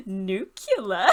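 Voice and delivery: pleased-with-yourself cartoon "nuclear", said NOO-kyuh-ler; imitating Homer. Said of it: "how happy he sounds with himself".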